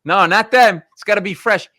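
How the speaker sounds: loud, emphatic Italian family refusal; hammed-up indignant protest; mock insistence.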